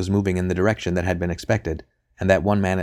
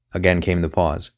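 ordinary delivery

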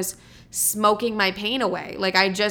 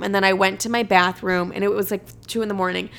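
usual speaking voice

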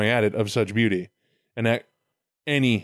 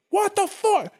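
very high-pitched mock outrage; hammed-up, incredulous squawk of anger; angry God. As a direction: play a God storming in, voice leaping up. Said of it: "his anger hurls Lilith out".

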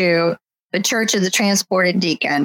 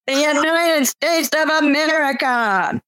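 shouted, higher; rally shout; 8 semitones higher than usual. A narrator's reading. Preacher roaring to adoring crowd, shouting. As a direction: bellow it to the crowd.

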